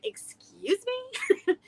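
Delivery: very slow, high, offended; outraged little dog, mock; indignant excuse me. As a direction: draw it out like a diva. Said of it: "he's like".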